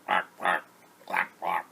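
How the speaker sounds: short duck quacks in pairs. Acted. Duck beeping by the pond, short quacks.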